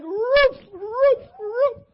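high, loud shouted barks; crying barks. Retold or imitated. Preacher barking for God in front of the church, shouted. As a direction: bark out like you mean it. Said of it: "While you barking".

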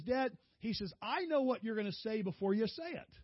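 son's voice. Retold or imitated. Son teasing dad about presentation.

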